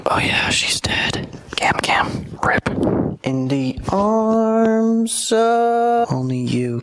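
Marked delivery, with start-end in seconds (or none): 0.1-2.7 whisper. Acted. Whispered joke aside.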